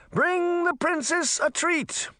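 very high, slow, stretched-out call; shouted order. A narrator's reading. Merman relays the princess's order, high drawn-out call. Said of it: "A second merman soldier shouted".

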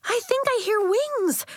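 put-on very high, eager child's voice; excited cry. A narrator's reading. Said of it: "cried Nori".

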